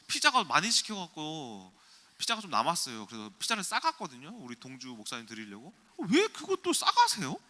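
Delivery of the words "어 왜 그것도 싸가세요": high, loud imitation of an appalled date; appalled question. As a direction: squawk the question in mock disbelief. Mimics date's appalled question. (어이없어하며 높고 크게 묻는 흉내)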